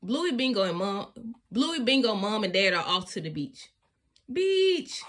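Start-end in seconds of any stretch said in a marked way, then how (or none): 4.3-5.0 excited cry. A narrator's reading. Kids excited to reach beach.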